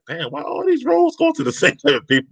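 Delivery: voiced way up high in a half-laughing complaint; exasperated complaint. To the hosts, voicing what people now ask about casting, half-laughing. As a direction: toss off the gripe with a laugh breaking in. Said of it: "you're starting to really like".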